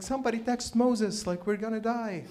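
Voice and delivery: high-pitched panicked cry; mock panic. Peter in the storm begging for help, mock panic. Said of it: "the disciples are petrified".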